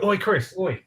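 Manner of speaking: high call across the street to get attention. Shown as a demonstration. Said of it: "kinda like trying to get somebody's attention".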